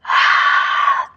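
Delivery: long hard breath out on exertion; forceful exhale. Real, not acted. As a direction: blow the air out sharply with effort. Exhaling through exercise reps.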